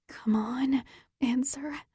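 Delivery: tense urgent whisper at the phone; will her friend to pick up under your breath; whisper to herself. Acted. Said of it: "I whisper to myself".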